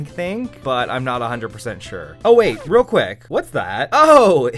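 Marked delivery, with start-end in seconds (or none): 2.2-4.5 mock surprise. Mock interruption before sponsor ad.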